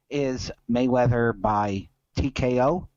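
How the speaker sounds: ordinary talk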